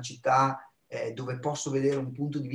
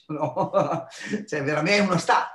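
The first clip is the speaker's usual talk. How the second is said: laughing; 2 semitones higher than usual. Real, not acted. Podcast guest on [name]'s wild anecdotes, laughing. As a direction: blurt it out through a laugh.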